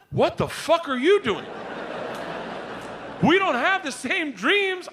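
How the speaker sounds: high mock-outraged telling-off; exasperated wife. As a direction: imitate a wife scolding, pitched high. Wife scolding husband at breakfast.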